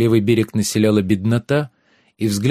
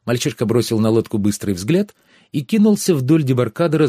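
ordinary delivery

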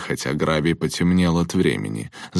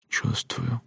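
hushed, much softer and higher; whisper; 6 semitones higher, 8 dB quieter than usual. A narrator's reading. Bertie answers his friend's uneasy question, whispering. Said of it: "прошептал Берти".

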